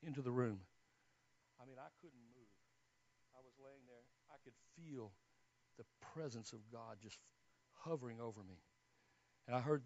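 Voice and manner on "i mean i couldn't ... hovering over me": very soft, almost under the breath; barely audible, overwhelmed; hushed awe. Retold or imitated. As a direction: recall it in a reverent hush. Recalling being overwhelmed by God's presence.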